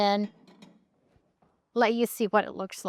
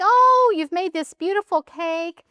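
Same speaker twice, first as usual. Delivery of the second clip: high, louder gushing "Oh," easing down; delighted guest; 7 semitones higher, 4 dB louder than usual. Pretend. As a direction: gush like an impressed guest. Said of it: "your guests come or your family comes and they think".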